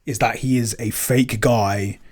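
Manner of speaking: normal talking voice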